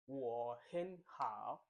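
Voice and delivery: WO HEN HOW, slow, HEN lifted and HOW dipping; third tone. Shown as a demonstration. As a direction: draw out WO HEN HOW, swooping down and up on HOW. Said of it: "the third one".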